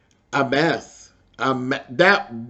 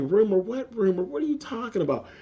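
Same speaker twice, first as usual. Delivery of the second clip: higher and higher, baffled: rumor, what rumor; confused surprise; 9 semitones higher, 3 dB quieter than usual. Retold or imitated. Ralph hearing the rumor for the first time, baffled and rising high. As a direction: caught off guard, pitch climbing on each question. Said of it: "he's like".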